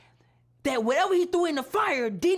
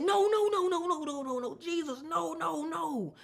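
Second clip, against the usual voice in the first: anguished pleading nos; 4 semitones higher than usual. Real, not acted. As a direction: beg and fade out. Desperate denial in prayer.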